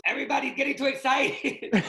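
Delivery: hammed-up Spanish accent, yelling. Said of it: "he goes".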